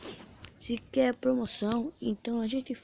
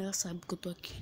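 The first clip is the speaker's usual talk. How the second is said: whisper; 4 semitones lower, 10 dB quieter, much faster than usual. Real, not acted. Hiding from enemy.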